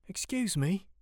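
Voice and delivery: unsure, high-pitched polite query; nervous stutter. A narrator's reading. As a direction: approach him haltingly, voice lifted. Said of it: "Samuel stutters".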